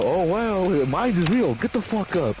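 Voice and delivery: high, taunting mock guard voice. Guard taunting the teen robbers, mock high voice. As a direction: sneer it out, gun in hand. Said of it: "you hear the guard go".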